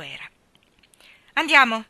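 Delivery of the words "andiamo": sharp, stern command, much louder; stern loud order. A narrator's reading. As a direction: snap it like a strict nanny. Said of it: "disse severa".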